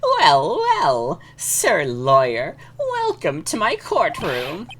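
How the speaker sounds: slow, high, swooping theatrical greeting; flamboyant, drawn-out villainous welcome; pompous detective. Acted. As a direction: savor each word with hammed-up grandeur. Voices pompous detective Atmey.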